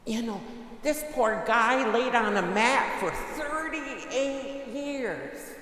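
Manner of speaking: preacher demanding an answer; full-force preaching. Real, not acted. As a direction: thunder it at the congregation. Preaching on the gospel, rising.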